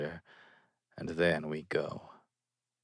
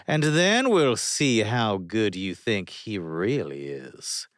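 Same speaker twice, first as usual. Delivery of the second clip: plain reading voice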